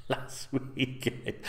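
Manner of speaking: real laugh breaking into the words; slow, quiet words squeezed through laughter. Real, not acted. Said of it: "Don't know why I'm laughing".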